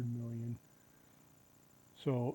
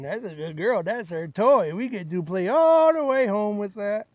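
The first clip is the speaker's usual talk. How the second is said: lightly raised, fond dog praise; praising a dog; 6 semitones higher, 9 dB louder than usual. Real, not acted. Dog playing with her find.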